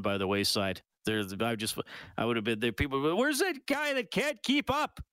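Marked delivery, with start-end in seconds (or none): 3.2-5.0 high, slow mocking call; old-time miner calling out; gruff miner voice. Voicing gold rush people.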